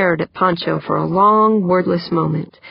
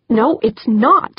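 slow, higher-pitched firm denial; retort with quiet vehemence; 6 semitones higher, slower than usual. Acted. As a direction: contradict firmly, dragging the words out. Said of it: "Wonderly retorted".

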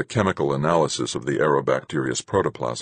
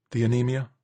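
higher-pitched woman's voice, questioning; worried woman character; 8 semitones higher than usual. Acted. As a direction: ask it high, concerned. Voices Marguerite in story.

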